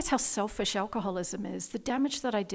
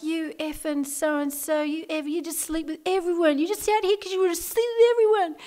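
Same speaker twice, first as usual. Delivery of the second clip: rising, higher furious accusation; mock drunken rage, getting higher and louder; angry drunken yell; 9 semitones higher, 5 dB louder than usual. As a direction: shout the accusation at him, building. Drunken street fight with boyfriend.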